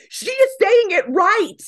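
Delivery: high, loud indignant outburst; upset protest. Retold or imitated. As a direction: re-enact yelling in someone's defence. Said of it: "I was so upset".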